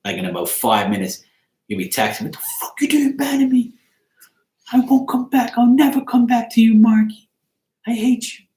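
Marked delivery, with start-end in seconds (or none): 2.2-8.5 high-pitched indignant mock rant; mock outraged texter. Banned viewer texting to complain, mock outraged. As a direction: whine it high, like someone outraged at being banned.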